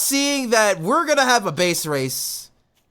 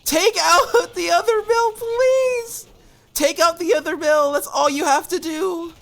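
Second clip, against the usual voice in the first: high, pleading and fast, laughing once; frantic pleading; 7 semitones higher than usual. Real, not acted. Caster begging his player's army to take the mill. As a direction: beg the unit not to blunder.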